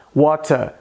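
"water" with crisp T and silent R, British; British accent. Shown as a demonstration. Teacher comparing three accents, British accent. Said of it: "Já no inglês britânico soa".

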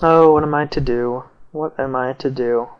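ordinary talk, nothing marked

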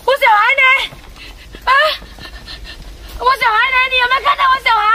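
put-on frantic mother searching for her child; panicked. Mother asking a passer-by about her missing child, panicked.

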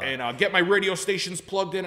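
the customer bossing the salesman around, in a high whiny voice; bossy customer. Retold or imitated. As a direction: order Brian around like a spoiled client. Demanding customer ordering Brian around.

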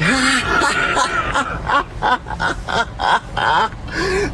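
very high cackling laugh; shrieking high laugh, imitated; imitated cackle. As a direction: cackle like a grandma cracking herself up. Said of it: "dies laughing after".

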